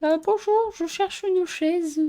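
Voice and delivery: high, drawn-out polite little voice; put-on polite inquiry. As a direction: ask in a high, slow pretend-customer voice. Asks an empty game world for chairs.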